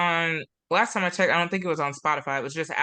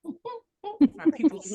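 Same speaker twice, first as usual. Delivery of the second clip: playful echo; 10 semitones higher, much slower than usual. Retold or imitated. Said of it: "my peoples is from the South".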